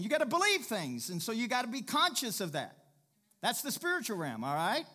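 high, loud, impassioned exhortation; full-force preaching. Real, not acted. Preacher telling church to be conscious of that, impassioned.

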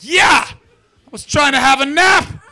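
put-on high, loud, slow furious old Brit shouting; angry old Englishman. Old Englishman shouting at the soldiers, high and loud. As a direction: bellow like a grumpy old man woken from a nap. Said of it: "And he's like".

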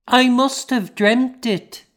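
put-on high little girl's voice, bewildered; a small girl trying to believe it; lost child. A narrator's reading. Confused grieving child.

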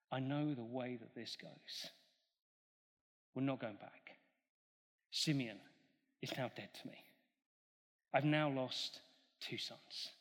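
the grieving Jacob, low and soft; despairing old father. Acted. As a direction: let the old father give up. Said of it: "still despairing".

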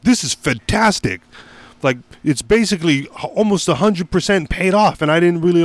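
high, thrilled imitation of the buddy; mock excited buddy. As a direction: gush like the friend who paid it off.

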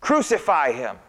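high, loud demand; shouting. Acted. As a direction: yell at the governor. Crowd keeps shouting.

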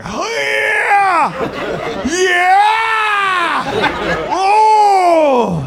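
high shouted moaning oh yeah; loud bedroom moan. Retold or imitated. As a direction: ham up a loud sex moan. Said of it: "your buddy's moans in the bedroom".

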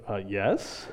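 low, small, sheepish voice; meek answer. Retold or imitated. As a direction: answer softly, as if told off. Cowed answer to stern attendant.

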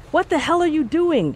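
sharp high startled shout; startled high shout at a stranger. A narrator's reading. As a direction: snap it out high and alarmed. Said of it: "she shouted".

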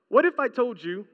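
usual speaking voice

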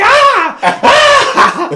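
very high, loud comic yelps; hammed-up shrieks through laughter; mock scream of pain. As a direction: scream like a dog just bit you. Retells his cry as dog bites.

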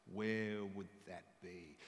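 ordinary talk, nothing marked

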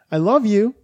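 high-pitched mock affection; imitating the rescuer. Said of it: "And the rescuer said".

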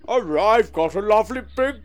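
exaggerated funny voice; comic character voice. Shown as a demonstration. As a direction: play it as a daft novelty character.

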